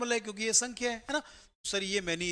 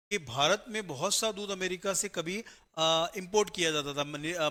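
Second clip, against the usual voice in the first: ordinary talk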